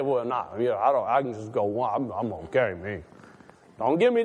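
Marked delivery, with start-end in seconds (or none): 0.0-3.0 low, mumbled fake-humble refusal; mock a churchgoer politely turning down seconds; mock modest excuse. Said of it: "Don't even try to say".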